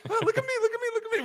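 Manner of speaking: high, laughing mock attention-seeker; mocking whine. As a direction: whine it like a show-off. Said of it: "It's like".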